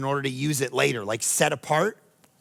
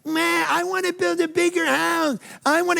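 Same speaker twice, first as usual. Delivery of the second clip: put-on much higher, whining child's voice; whiny kid; 12 semitones higher than usual. Pastor voicing a believer whining to God for a bigger house. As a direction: whine it like a spoiled kid. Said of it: "you sound like a whiny kid".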